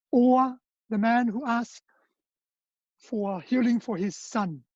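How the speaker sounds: normal talking voice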